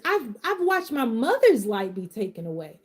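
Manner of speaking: usual speaking voice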